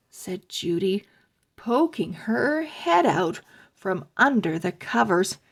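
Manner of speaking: usual speaking voice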